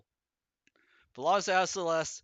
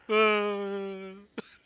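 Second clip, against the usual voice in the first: laughing; 4 semitones higher, 3 dB louder than usual. Real, not acted. Streamer laughs after echo.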